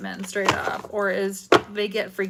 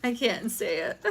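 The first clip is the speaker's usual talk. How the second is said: normal talking voice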